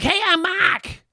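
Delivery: loud, high, drawn-out Boston shout; imitating a kid yelling in a thick Boston accent. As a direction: holler it with a Boston accent, dropping the R. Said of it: "It's a Boston accent".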